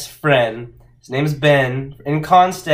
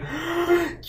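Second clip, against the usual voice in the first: exaggerated offended gasp; high, hammed-up intake of breath; mock shocked gasp; 6 dB quieter than usual. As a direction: gasp like a scandalised commenter. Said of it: "go in the comments and be like".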